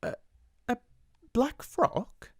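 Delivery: stumble, flustered and unsure; stammering. Acted. Amelia answers her fierce sister, stammering. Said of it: "Miss Amelia stammered again".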